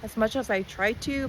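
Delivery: usual speaking voice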